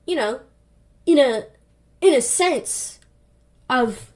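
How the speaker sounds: halting, with long gaps between bits; evasive stalling. Retold or imitated. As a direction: hedge word by word, buying time. Evasive answer about baby's age.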